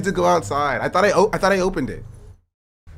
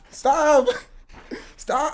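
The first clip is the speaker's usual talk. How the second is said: calling out; 9 semitones higher than usual. Real, not acted. Dog attacking, calling out.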